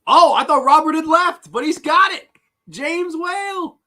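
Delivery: high, loud burst of surprise; high-pitched, thrilled quiz host; excited announcing. Real, not acted. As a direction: cry out the winner's answer in delight. Reacting to a player's correct answer.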